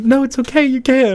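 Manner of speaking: high-pitched, sing-song encouragement; imitated reassurance. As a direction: voice a teacher soothing a doubtful student. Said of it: "And she was like".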